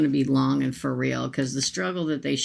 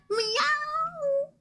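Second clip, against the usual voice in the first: quoting the cat in a high meow; mock meow. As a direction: squeak a tiny kitty meow. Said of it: "She said".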